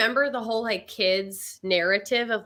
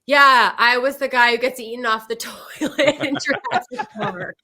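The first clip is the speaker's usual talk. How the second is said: louder, laughing through the words; laughing admission; 2 semitones higher, 7 dB louder than usual. Retold or imitated. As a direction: crack up while confessing it. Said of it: "he just has to be like".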